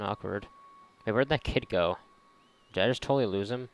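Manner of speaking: usual speaking voice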